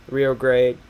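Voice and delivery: GRANDE said as "grade"; one-syllable GRANDE, like "grade"; mispronounced GRANDE. Real, not acted. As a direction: say GRANDE to rhyme with "trade". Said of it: "forget how to pronounce Grande".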